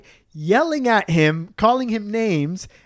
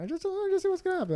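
high, quick, soft protest; high-pitched pleading quote; 12 semitones higher, 8 dB quieter, much faster than usual. Retold or imitated. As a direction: pitch it up, hurried and small. Quoting own reluctant plea to passenger.

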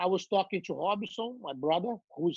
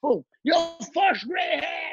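excited daughter; 9 semitones higher, slower than usual. Retold or imitated. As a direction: crow it out triumphantly. Daughter announces gray hair.

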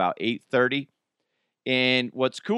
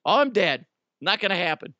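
resigned mock despair; 8 semitones higher than usual. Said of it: "I'll be like".

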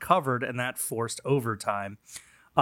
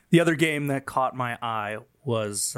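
normal talking voice

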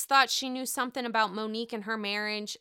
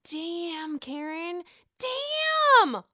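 stunned, the last damn soaring high and loud; shocked damn; 6 semitones higher, 5 dB louder, much slower than usual. Real, not acted. Reacting to Karen's remark with a shocked, soaring damn. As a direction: let the final damn jump way up in disbelief. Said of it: "All I have to say is".

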